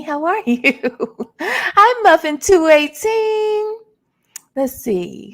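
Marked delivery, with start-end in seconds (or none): 1.4-3.8 high, loud hello through a laugh; laughing greeting. Real, not acted. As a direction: giggle your way into the name.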